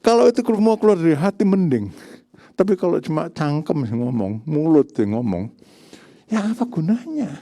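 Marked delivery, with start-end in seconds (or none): none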